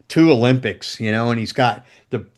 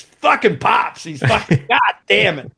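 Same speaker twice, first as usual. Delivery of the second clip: higher, imitated delighted swearing; retold happy outburst, pitched up; excited exclamation; 8 semitones higher than usual. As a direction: act out the bassist's grudging thrill.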